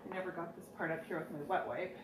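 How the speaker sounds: normal talking voice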